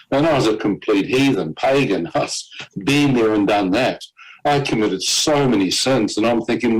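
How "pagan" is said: normal talking voice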